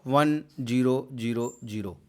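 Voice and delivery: "वन zero zero zero" spoken as four separate digits. Shown as a demonstration. Teacher repeating the binary reading for students, "वन zero zero zero" digit by digit. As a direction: read out "वन zero zero zero" digit after digit. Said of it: "इस तरीके से इसको पढ़ेंगे".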